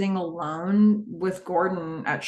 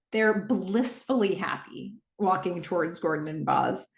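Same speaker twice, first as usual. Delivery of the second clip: ordinary talk, nothing marked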